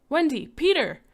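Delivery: high, loud falling shout; alarmed shout. Acted. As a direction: shout in sudden panic. Door slammed, panic.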